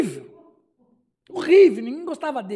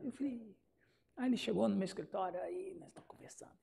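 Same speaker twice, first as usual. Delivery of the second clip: usual speaking voice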